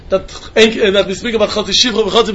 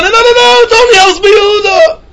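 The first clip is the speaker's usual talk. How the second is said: emphatic exclaim; 11 semitones higher, 5 dB louder than usual. Acted. Emphatic rejection of earlier reading.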